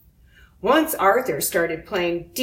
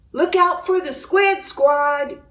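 higher excited game yell; 8 semitones higher, 3 dB louder than usual. A narrator's reading. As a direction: call out the warning, high and keen. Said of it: "yelled Buster".

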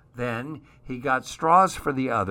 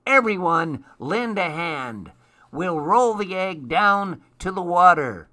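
high, insistent ordering; rally everyone as a cross storybook gnome; bossy gnome; 6 semitones higher, 4 dB louder than usual. A narrator's reading.

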